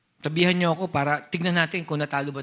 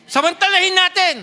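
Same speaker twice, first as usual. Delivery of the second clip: rousing urge; 12 semitones higher, 9 dB louder than usual. Real, not acted. Preacher urges congregation.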